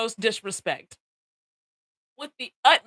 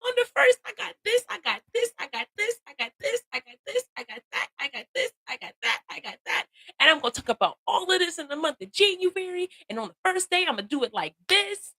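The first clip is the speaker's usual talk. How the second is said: high squeaky excited voice; playful high-pitched hype; mock hyped self; 11 semitones higher than usual. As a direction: imitate your past self getting hyped, pitched way up. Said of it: "I was like".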